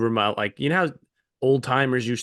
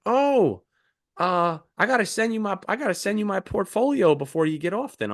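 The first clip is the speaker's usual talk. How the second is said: higher, chatty driver voice pushing his portfolio; eager bus driver; 7 semitones higher than usual. Retold or imitated. Shuttle driver pitching his photos to passengers, high eager voice. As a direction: play the overeager stranger, pitched up. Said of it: "He's like".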